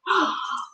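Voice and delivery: soft, high intake of breath; delighted gasp. Real, not acted. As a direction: gasp in happy surprise. Gasps at the next item.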